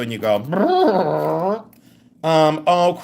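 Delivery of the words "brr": trombone brr. Retold or imitated. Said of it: "Why did you sound like that".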